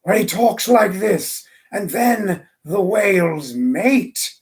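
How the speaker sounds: slow, drawn-out nature documentary narrator impression; David Attenborough. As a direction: imitate a TV naturalist, stretching the words out. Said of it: "his impersonation of David Attenborough".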